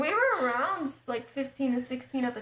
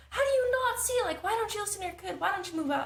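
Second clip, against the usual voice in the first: exasperated; 8 semitones higher than usual. Retold or imitated. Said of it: "we're like".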